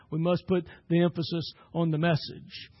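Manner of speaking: ordinary delivery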